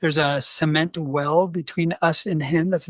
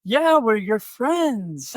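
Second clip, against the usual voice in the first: high, drawn-out, chummy mock reassurance; slowly singing out friendliness in a raised voice; over-friendly placating; 7 semitones higher, much slower than usual. Missionary appeasing a drunk with a knife, over-friendly.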